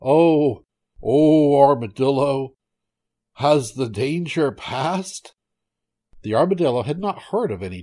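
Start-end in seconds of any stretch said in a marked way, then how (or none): 0.0-5.3 loud, drawn-out tiger call; raised, louder hail slowing into a question. Acted. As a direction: call out to a passer-by, stretching the end. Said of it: "the tiger called out".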